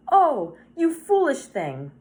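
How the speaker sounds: high, loud, slow cry of dismay; dismayed lament. A narrator's reading. Woman over her dead cat, dismayed lament. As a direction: draw out a pained scolding.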